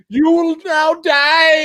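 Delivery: imitating the movie Nazi soldier's menace; Nazi villain. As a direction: ham up the villain's threat. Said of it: "he's all like".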